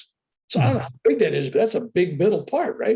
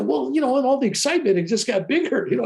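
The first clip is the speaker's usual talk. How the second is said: sheepish own reply; 9 semitones higher than usual. Retold or imitated. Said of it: "I go".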